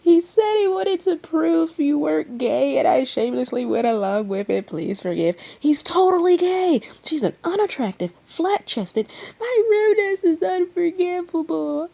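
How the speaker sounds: very high, loud overlapping voices; frantic clamor. Acted. Crowd clamoring around Ren, shrill and loud. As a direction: pile on, all shouting at once.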